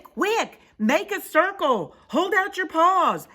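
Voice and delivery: high, loud orders; urgent yell. Acted. Pip organising the catch, urgent yelling. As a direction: bark out rescue orders. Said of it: "yelled Pip".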